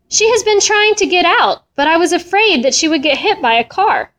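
high, plaintive upset; tearful. A narrator's reading. Missus Katz fearing for her lost cat, tearful. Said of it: "Missus Katz said through her tears".

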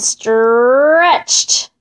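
STRETCHED held long, sliding upward; drawn-out word. A narrator's reading. Llama stretches her neck to the moon, drawn-out word.

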